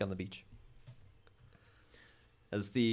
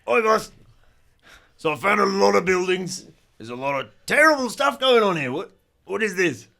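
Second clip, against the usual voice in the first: much higher, louder gang minion calling his boss; rough minion voice; 10 semitones higher, 14 dB louder than usual. Acted. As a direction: shout it up to the boss like an eager henchman. GM voices Dez on radio.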